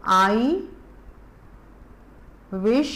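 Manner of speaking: word by word with long pauses for repeating; slow model reading. Shown as a demonstration. Teacher reading a poem line for children, slow and separated. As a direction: read each word slowly for kids to echo. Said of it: "एक बारी फिर बोलो मेरे पीछे".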